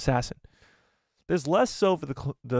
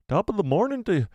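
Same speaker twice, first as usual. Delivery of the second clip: lilting, higher stab at an Irish accent; attempted Irish accent; 7 semitones higher than usual. Shown as a demonstration. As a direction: try out an Irish accent, not quite locked in. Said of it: "I'll do my Irish accent again".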